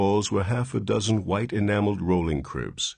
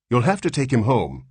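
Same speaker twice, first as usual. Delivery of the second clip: louder, firm nurse; insistent; 6 semitones higher, 5 dB louder than usual. Acted. Nurse to the father, insistent and firm. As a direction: insist firmly. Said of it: "insisted the nurse".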